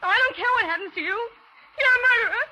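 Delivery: high, desperate, frightened pleading threats; panicked accusation. Acted. Captive woman threatening the murderer, high and panicked. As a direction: hold him off, voice high with panic. Accusing killer, gun in hand.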